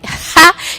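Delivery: sudden loud high burst of laughter. Real, not acted. Host laughing at his own retelling, a loud burst of laughter. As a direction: break into a big laugh.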